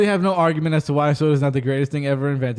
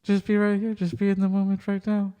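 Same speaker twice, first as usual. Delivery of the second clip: mild guru voice; 3 semitones higher than usual. Retold or imitated. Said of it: "He goes like this".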